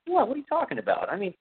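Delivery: mock innocent guy, pitched high; a lightly hammed-up smooth talker brushing off her fear; high, coaxing, slowing at the end; Tom Savini playing innocent. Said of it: "And Tom was like".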